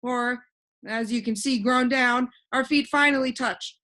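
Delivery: put-on a cheerful know-it-all boy floating in the air; voiced boy Alec.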